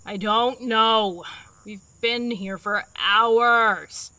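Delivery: loud, slow, drawn-out complaint; loud, stretched-out exasperation; exasperated. Acted. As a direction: groan the words out in frustration. Frustrated after hours of failing.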